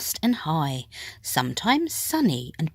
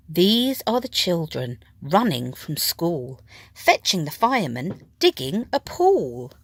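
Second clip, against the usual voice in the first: usual speaking voice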